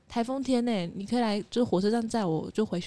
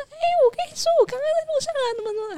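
mock loud, much higher chatter; loud talker; much faster than usual. Retelling the loud friend on the scooter, mock loud high chatter. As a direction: mimic a friend blurting far too loudly, pitched way up. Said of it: "他讲话超大声".

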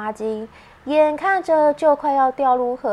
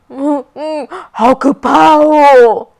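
scared; 2 semitones higher, 12 dB louder, slower than usual. Acted. Ouqi scared of thunder.

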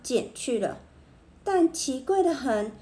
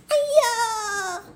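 high, long drawn-out yelp; pained cry; 9 semitones higher, 3 dB louder than usual. A narrator's reading. Barber nicks his own scalp, high pained cry. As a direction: cry out in sudden pain. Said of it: "他大叫起来".

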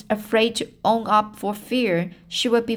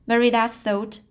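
ordinary delivery, nothing marked

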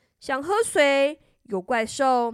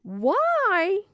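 high asking queen; a puzzled mother; 7 semitones higher than usual. A narrator's reading. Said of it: "said the queen".

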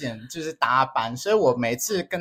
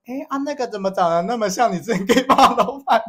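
laughing; 10 semitones higher, 5 dB louder than usual. Retold or imitated. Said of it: "就会说".

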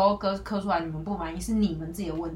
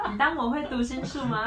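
laughing retort; 7 semitones higher than usual. Acted. Mock retort to silent customer.

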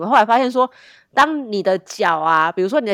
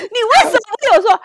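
mock whiny quote; 14 semitones higher, 6 dB louder, faster than usual. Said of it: "还说".

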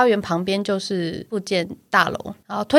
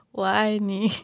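drooling mumble; 6 dB quieter, slower than usual. Retold or imitated. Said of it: "闭着眼睛，然后流着口水说".

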